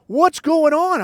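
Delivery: retold high, loud alarm; imitated panicked outburst; freaked out. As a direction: blurt it out high like a startled kid. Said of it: "I'm freaked out".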